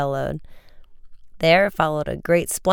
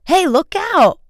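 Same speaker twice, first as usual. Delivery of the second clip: high, loud sudden alarm; sharp cry of alarm, much higher and louder; sharp warning cry; 7 dB louder than usual. A narrator's reading. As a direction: snap out a warning as the boat bears down. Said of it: "Frank cried sharply".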